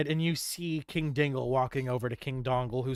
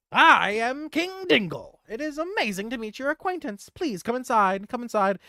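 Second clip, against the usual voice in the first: squeaky gnome king greeting; 9 semitones higher, 4 dB louder than usual. Acted. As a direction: welcome them like a tiny pompous king. GM voices King Dingle.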